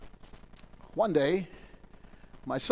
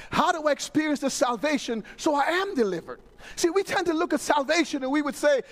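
usual speaking voice

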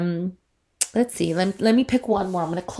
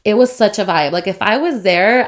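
usual speaking voice

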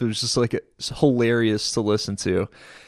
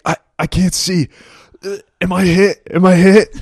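high, loud, panicky yelling; panicked; 7 semitones higher, 8 dB louder than usual. Retold or imitated. Wounded buddy shouting to the speaker in alarm. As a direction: play a dazed soldier who can't see. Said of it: "He's like".

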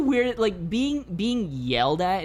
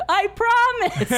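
high, loud, drawn-out plea; retold yell back; 5 dB louder, much slower than usual. Resident calling after a departing cyclist, yelling. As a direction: re-enact a desperate yell after someone. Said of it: "So I just yelled back at her".

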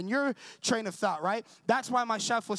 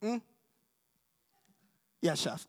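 playful mock; 4 semitones lower than usual. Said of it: "sometimes I'd play with him".

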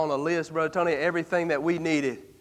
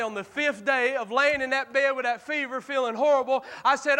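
ordinary talk

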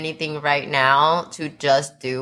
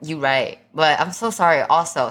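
ordinary talk

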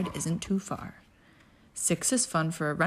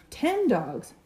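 usual speaking voice

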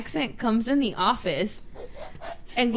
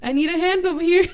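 mock surgeon call; 7 semitones higher, 3 dB louder, faster than usual. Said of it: "Now I'm over there like".

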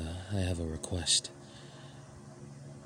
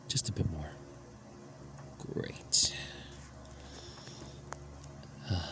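whispering; 8 dB quieter than usual. Acted. Intimate scene, whispered guidance.

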